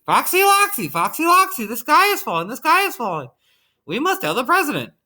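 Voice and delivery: squealed poultry alarm; high yelling. A narrator's reading. Birds alerting the fox outside the airport, yelling high. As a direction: yell it high and frantic. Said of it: "yelled Chicken Licken".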